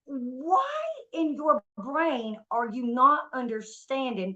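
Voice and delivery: slow, high-pitched exasperation; incredulous, stretched-out scolding; exasperated disbelief. Retold or imitated. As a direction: drag out each word in disbelief. Acted confrontation with the thief.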